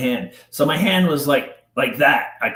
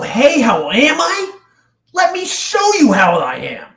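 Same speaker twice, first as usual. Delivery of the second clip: higher and louder, rising to a threatening challenge; retold angry retort; 11 semitones higher, 5 dB louder than usual. Victim confronting his abuser at the door, retold fury. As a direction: snap it back as the rage breaks loose. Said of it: "That set me over the edge".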